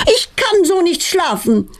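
high, exasperated yell; yelling mother. A narrator's reading. Mother woken by crickets, yelling high through the flat. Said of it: "brüllt Petronellas Mutter laut".

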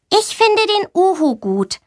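high, small voice of a shy schoolgirl; shy girl Mia. A narrator's reading. Shy girl answers the teacher in class, high and timid. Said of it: "sagt Mia".

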